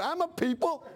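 laughing at his own joke. Real, not acted. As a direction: break into a laugh on the line.

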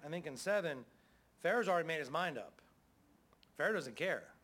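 ordinary talk, nothing marked

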